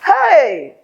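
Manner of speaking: high loud cry of shame; embarrassed shout. Real, not acted. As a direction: yell out in embarrassment. Embarrassed exclamation.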